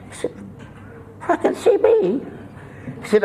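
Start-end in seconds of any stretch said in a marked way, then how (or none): 1.3-2.2 high incredulous squawk; shocked patient. Retold or imitated. Patient shocked at the doctor's diagnosis, high squawk. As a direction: yelp it in disbelief.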